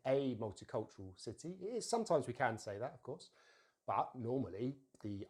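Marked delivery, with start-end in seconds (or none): 0.1-1.6 "a" said as AY before multicultural city; strong article "a". Shown as a demonstration. Teacher showing learners what we don't normally say, strong AY for "a". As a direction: give "a" its full letter-name vowel, AY. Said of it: "we don't say".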